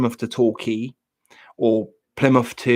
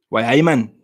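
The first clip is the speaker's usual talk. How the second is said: quick mock Geordie catchphrase; Geordie accent; 5 dB louder than usual. Chatting about strong northern accents, mock Geordie. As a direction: toss out a playful Geordie accent. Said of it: "yeah, Geordie".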